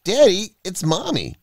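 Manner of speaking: put-on small child calling out urgently; child's excited cry. A narrator's reading. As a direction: cry out to Daddy like a five-year-old. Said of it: "the now five-year-old cried".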